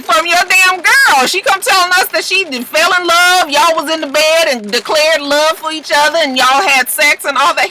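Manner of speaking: high, loud scolding retelling; mock angry accusation. Daughter confronts Bishop about his girl, hammed-up accusation. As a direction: throw it at him at the top of your range.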